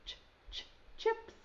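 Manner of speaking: ch, ch, chick with the ch sound split off and repeated; segmented ch sound. Shown as a demonstration. Teacher sounding out the first sound for young kids. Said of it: "Does chips".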